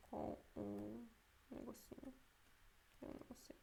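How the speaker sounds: very soft, fading into a whisper; muttering to self. Real, not acted. Teacher unsure of the spelling, muttering to self. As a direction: think aloud under the breath, barely voiced. Unsure, thinking aloud to herself.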